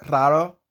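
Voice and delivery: "raro" starting on a trilled r; rolled R example. Shown as a demonstration. Teacher giving the third example, rolled r in raro. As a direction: roll the first r of "raro". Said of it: "by rolling your tongue".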